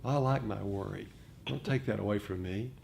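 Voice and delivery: normal talking voice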